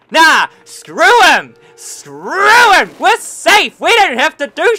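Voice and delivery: mock the soldiers hiding in the bunker; mock lazy soldier. Gamer mocking his soldiers hiding safe in a bunker, hammed-up loud voice. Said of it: "their bum going".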